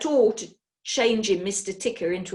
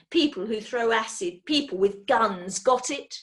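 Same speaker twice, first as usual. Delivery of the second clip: threatening villain. Acted.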